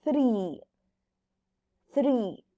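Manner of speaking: "Three" with the tongue between the teeth for the TH. Shown as a demonstration. Teacher models "Three" so students watch the tongue. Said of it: "Sí ven cómo hago la lengua".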